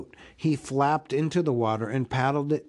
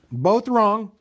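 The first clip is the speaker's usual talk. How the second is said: higher, louder triumphant call; relieved cry; 8 semitones higher, 7 dB louder than usual. A narrator's reading. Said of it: "cried Bilbo".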